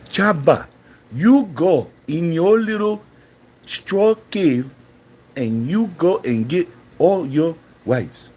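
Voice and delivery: hammed-up African accent, scolding in short phrases; mock African accent. Addresses Chaba in mimicked accent.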